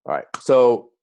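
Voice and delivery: ordinary talk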